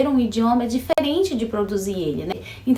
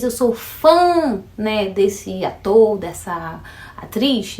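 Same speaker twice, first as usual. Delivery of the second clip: drawn-out fã with a mock-gushing tone; fan gush, slowed; much slower than usual. Mimicking a fan, slow and playful. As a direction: gush slowly about being a fan. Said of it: "quando você diz".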